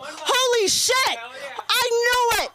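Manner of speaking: high, slow, exaggerated gasp of recognition; mock shock. Comic teasing a crowd member, high mock shock. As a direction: ham up the shock.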